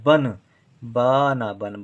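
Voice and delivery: बना बन drawn out syllable by syllable, very slowly; slow spelling-out. Shown as a demonstration. Teacher sounding out a word for pupils, very slow. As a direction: stretch each sound of बना बन for the class. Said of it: "दोनों अक्षर देर देर तक हम बोल रहे हैं".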